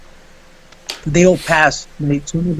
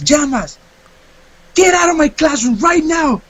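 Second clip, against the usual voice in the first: higher, firm mock command; angry teacher order; 9 semitones higher than usual. Teacher ordering student out, higher angry command. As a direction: order him out like a furious teacher.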